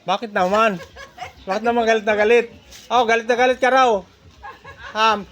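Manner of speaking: high, loud playful teasing. Real, not acted. Collector teases the angry suki, loud and high.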